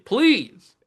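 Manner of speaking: "please" jumps up high in a desperate plea; pleading please. Real, not acted. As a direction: beg with a sudden high "please". Begging a recruit to commit.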